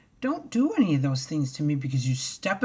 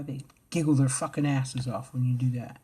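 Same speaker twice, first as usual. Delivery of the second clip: ordinary talk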